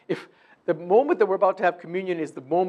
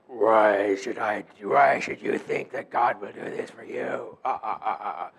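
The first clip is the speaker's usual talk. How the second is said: mock devil voice; 7 semitones lower than usual. Shown as a demonstration. Preacher mocking how people picture the adversary speaking, hammed-up devil voice. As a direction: ham up a deep sinister villain, ending in a slow chuckle. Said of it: "That's not how he talks".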